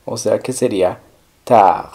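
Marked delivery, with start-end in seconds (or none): 1.4-1.9 tard said "tar", no D at the end; silent final D. Shown as a demonstration. Teacher showing learners French "late", tard without final D. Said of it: "la D del final no se pronuncia".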